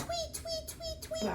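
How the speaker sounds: high quiet tweeting; bird tweets. Acted. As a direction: chirp like a little bird. Puppet bird noises.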